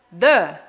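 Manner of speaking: "the" with a short uh vowel; "the" as thuh. Shown as a demonstration. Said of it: "som de consoante".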